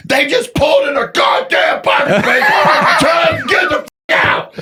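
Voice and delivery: mock furious yell. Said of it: "He goes".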